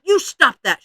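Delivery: loud, high indignant protest; angry. A narrator's reading. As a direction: stamp it out crossly, loud and high. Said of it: "she said angrily".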